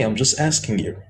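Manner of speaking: ordinary talk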